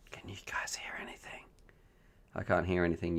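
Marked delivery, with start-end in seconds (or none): 0.1-1.4 whisper. Real, not acted. Waiting for call to connect.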